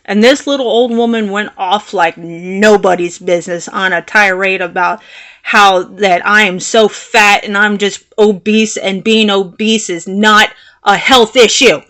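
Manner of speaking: ordinary delivery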